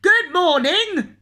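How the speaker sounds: loud, high, slow bellowed greeting. Acted. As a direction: boom it across the shop. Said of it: "bellowed the grocer".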